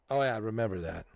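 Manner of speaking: usual speaking voice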